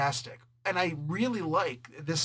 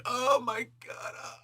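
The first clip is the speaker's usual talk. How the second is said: hammed-up horror, drawing out the words; mock anguish; 8 semitones higher than usual. Acts the guy's anguished reaction.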